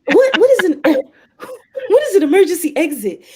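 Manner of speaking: high, incredulous retold question; baffled outburst. Traveler reacting to an official's absurd demand, incredulous.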